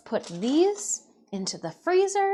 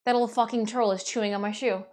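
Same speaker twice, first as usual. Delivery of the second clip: normal talking voice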